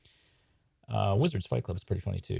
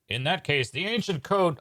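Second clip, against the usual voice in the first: high, declaimed magician voice; grand proclamation; 9 semitones higher than usual. Acted. Writer sketching an ending as magician, grand proclamation. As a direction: pitch it up like a pompous magician.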